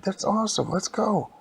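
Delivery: high-pitched acted excitement; excited. Character thrilled to steer a giant robot, high excited voice. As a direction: cheer on the adventure eagerly.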